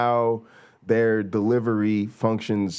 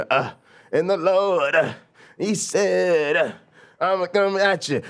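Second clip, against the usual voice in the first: higher, hammed-up Pentecostal preacher with an East Coast drawl, fast at the end; Pentecostal preacher grandpa; 7 semitones higher than usual. Mimicking his grandfather's preaching for the viewers, higher and hammed up. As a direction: play the fired-up old preacher, all uhs and drama. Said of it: "kinda East Coast drawl".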